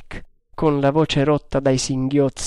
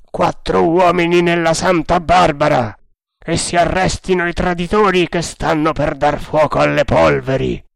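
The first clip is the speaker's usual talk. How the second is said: urgent, forceful command to the crew; shouted orders; 3 semitones higher, 4 dB louder than usual. A narrator's reading. Captain orders traitors arrested, urgent shouted command. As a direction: call the order out across deck.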